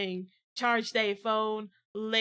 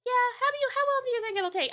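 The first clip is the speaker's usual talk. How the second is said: pitched way up, curious and a bit louder; high curious voice; 13 semitones higher, 4 dB louder, faster than usual. Acted. Customer asks the charger lady, voice pitched high and curious. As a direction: ask sweetly, voice lifted high.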